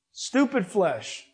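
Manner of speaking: ordinary delivery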